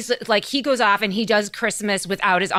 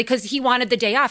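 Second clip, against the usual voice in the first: usual speaking voice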